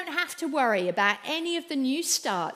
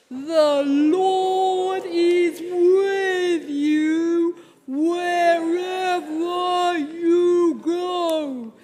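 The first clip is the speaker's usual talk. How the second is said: very slow, long stretched whale moan, louder; 4 semitones higher, 6 dB louder, much slower than usual. Shown as a demonstration. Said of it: "Let's try this together".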